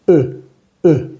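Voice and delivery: E as a rounded French "eu" vowel; drawn-out rounded French E; French schwa demo. Shown as a demonstration. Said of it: "Esta E se lee siempre".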